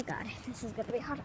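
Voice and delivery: breathless whisper on the bike. Real, not acted. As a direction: whisper it under effort. Whispered while starting to ride.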